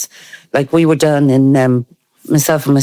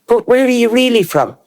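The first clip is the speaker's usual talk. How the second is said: higher, pressing where are you really from; mock pointed question; 9 semitones higher than usual. Retelling the boys from Mayo's doubting quiz, mock pointed. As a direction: lean on it with disbelief. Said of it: "And say".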